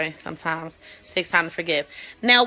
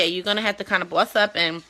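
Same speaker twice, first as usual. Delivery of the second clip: usual speaking voice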